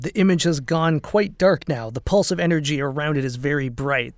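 ordinary talk, nothing marked

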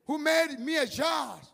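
high, loud reading of Jesus' reply. Acted.

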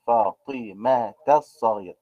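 slow, spelled-out فاطمة الصغيرة with a sounded t; teacherly slow linking of فاطمة through its ta; linked ta, syllable by syllable. Shown as a demonstration. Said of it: "أنطقها تاء".

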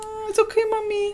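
put-on high little-kid voice; lightly mimicking a toddler; child's voice. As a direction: squeak it like a calm toddler. Imitating unbothered child.

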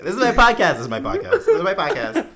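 squeaky mocking brag building up; mock podcast boast. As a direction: crow it like a smug host getting giddier.

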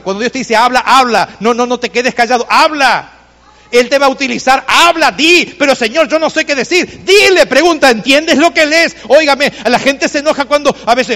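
high, driving sermon delivery; fervent preaching. Real, not acted. Pastor urging congregation to speak up, fervent preaching. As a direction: exhort the congregation with full force.

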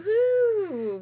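high, loud happy whoop; excited cheer. Real, not acted. Celebrating new podcast home.

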